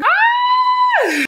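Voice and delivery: a sudden high squeal of delight; excited squeal. Real, not acted. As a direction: squeal like you just got the package you wanted. Squeals over package.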